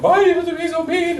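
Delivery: very high, loud whine; mock tearful wail. Hurt friend calling after sarcasm, mock tearful. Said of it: "bursts into tears".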